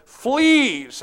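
a sudden high cry of alarm; cried out. A narrator's reading. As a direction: cry the word out, startled, pitched high. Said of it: "I cried".

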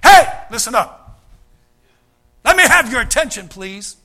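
loud, high call for attention; hey, listen up, call the room to order. Retold or imitated. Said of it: "kind of a statement like".

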